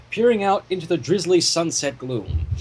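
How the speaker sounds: plain reading voice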